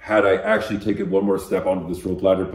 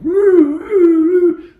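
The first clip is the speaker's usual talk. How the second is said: loud high imitated alarm siren; siren wail; 11 dB louder, much slower than usual. Telling a friend the alarm went off, loud siren imitation. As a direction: wail it out like a blaring alarm, much higher and louder.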